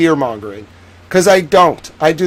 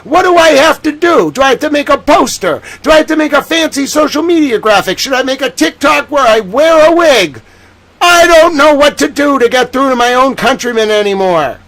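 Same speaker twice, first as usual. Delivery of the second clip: higher, loud desperate questions; exasperated outburst; 6 semitones higher, 3 dB louder than usual. Real, not acted. Streamer venting that no one listens to him, loud and exasperated. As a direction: throw your hands up in loud frustration. Exasperated rant about being ignored.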